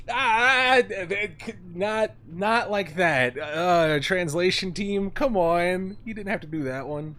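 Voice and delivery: loud stammering, sputtering protest; flustered outburst. Real, not acted. Streamer protesting the translators, loud and flustered. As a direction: splutter in disbelief.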